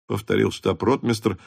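usual speaking voice

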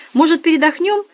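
put-on giddy party girl. Nastya chirps.

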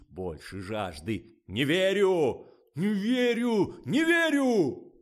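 high, loud, drawn-out director's commands; director yelling. A narrator's reading. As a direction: bellow orders at the model, stretching words. Director yelling at model during shoot. (режиссёр кричит на модель, громко и протяжно)

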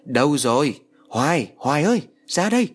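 loud, high desperate calling; yelling for wife. A narrator's reading. Husband stuck in the well yelling for Hoài, loud and high. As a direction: shout up the well for her to come. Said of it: "vẫn cố gào lên".

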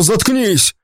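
acted outburst, pitch jumping up; angry command. A narrator's reading. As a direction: snap the order out angrily. Diego repeats his order angrily.

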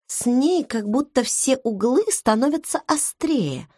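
ordinary delivery, nothing marked

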